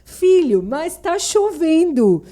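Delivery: high, loud, slow and probing; mother pretending to believe, loudly; mock suspicious mother. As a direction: grill the son like a doubting mom. Mother quizzing son by message.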